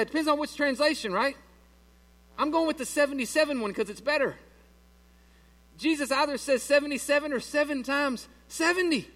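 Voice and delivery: high, loud preaching at full force; fiery preaching. Acted. Pastor preaching to the church, loud and high. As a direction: preach the point hard.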